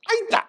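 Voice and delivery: "Aita" said eye-ta, very high; different pronunciation. Shown as a demonstration. Comedian trying the new name "Aita" again, high eye-ta pronunciation. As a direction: squeal "Aita" with an "eye" at the start. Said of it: "let me try a different pronunciation".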